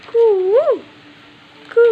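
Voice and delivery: long rising "koo" like a cuckoo; cuckoo call. Shown as a demonstration. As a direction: imitate a cuckoo for a little child. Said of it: "കുയിൽ എങ്ങനെയാ കരയുന്നേ". (കുയിലിന്റെ കൂവൽ)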